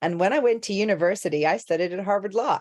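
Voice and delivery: re-enacted Californian introduction, American accent. Actor reciting accent spark back to coach, American accent. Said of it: "she was just like".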